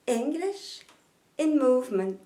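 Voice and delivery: English in movement with stress on ENG and MOVE; English accent, root syllables stressed. Shown as a demonstration. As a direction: native English rhythm. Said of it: "mon accent anglais est nettement meilleur".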